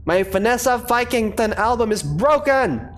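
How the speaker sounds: hammed-up Viking despair; upset Viking warrior. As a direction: wail it like a big baby warrior. Thor wails over broken album.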